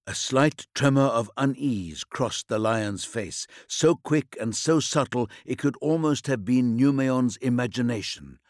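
usual speaking voice

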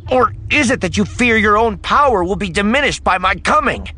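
very high, loud challenge; defiant taunt. Acted. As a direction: taunt the ruler before the whole crowd. Leia taunts the dynast publicly.

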